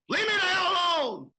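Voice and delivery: angry shout. Real, not acted. Angry rant at authorities.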